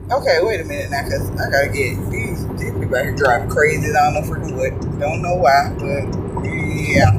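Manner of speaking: low annoyed grumble at the traffic; muttered at drivers. Real, not acted. Driving, muttering to careless drivers, low and annoyed. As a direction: grumble at the cars cutting in, under the breath.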